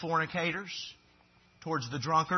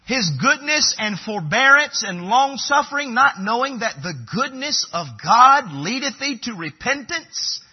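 high, loud pulpit reading; scripture reading; 7 semitones higher, 9 dB louder than usual. Acted. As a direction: ring the verse out to the congregation. Reads scripture aloud to congregation.

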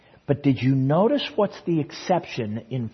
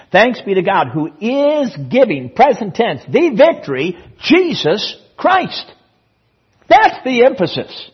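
high, loud, punched proclamation; full-force preaching; 9 semitones higher, 8 dB louder than usual. Real, not acted. Preacher proclaiming the New Testament's emphasis, full-force preaching. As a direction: hammer each phrase home to the congregation.

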